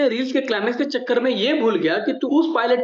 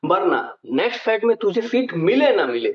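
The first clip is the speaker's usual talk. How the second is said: ordinary talk, nothing marked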